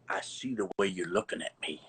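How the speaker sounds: a teacher's low, spooky line to a scared student; low ominous teacher voice. Retold or imitated. Teacher eyeing his student in a garage, low and ominous. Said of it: "and he goes".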